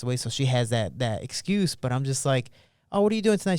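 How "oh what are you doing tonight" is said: higher-pitched, lightly put-on asking voice; mock a casual question, pitched up; imitated casual question. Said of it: "She goes".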